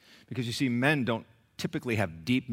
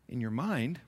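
normal talking voice

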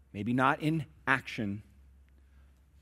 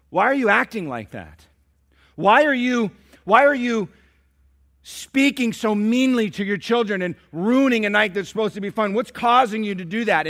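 higher, louder challenge; stern confrontation; 10 semitones higher, 9 dB louder than usual. Retold or imitated. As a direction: press the husband hard. Pastor confronting husband.

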